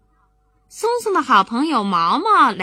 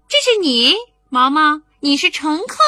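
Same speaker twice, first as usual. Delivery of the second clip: put-on high, lively little boy claiming the pilot seat; bright kid bossing his friend; child voice; 5 semitones higher, 5 dB louder than usual. Boy telling Maomao she's the passenger, high child voice.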